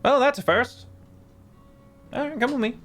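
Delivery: halfling innkeeper. Acted. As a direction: pipe up as a cheerful halfling.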